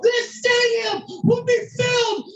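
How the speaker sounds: high, slow, shouted declaration with drawn-out words; fervent prophesying. Retold or imitated. Standing on chairs prophesying over the stadium, high impassioned shout. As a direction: proclaim it over a whole stadium at full force. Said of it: "we begin to prophesy over the stadium".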